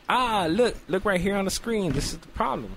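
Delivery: high, eager doctor voice, acted; doctor's discovery. Acts doctor pointing at screen.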